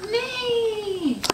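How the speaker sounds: high playful neigh; whinny it high like a pony; horse neigh. Pretend. Mom voices horse for toddler.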